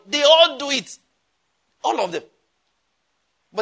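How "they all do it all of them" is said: high, loud cry of disgust; exasperated outburst. Real, not acted. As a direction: throw it out in exasperation. Exasperated climax of preaching.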